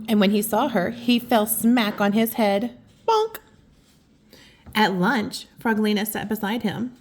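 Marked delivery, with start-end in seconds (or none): 3.1-3.4 high, loud cartoon bonk; bonk sound. Reader making the bonk as Froggy hits his head, high and loud. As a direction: pop the word out like a bump.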